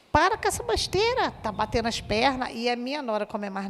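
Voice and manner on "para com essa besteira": mock scolding. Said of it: "a gente é de uma geração que".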